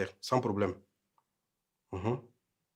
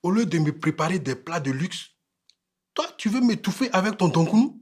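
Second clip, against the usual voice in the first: indignant complaint climbing higher; indignation; 6 semitones higher, 3 dB louder than usual. Acted. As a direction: reproach the wife, ending high and raised. Husband scorns wife's cooking. (reproche indigné, voix qui monte)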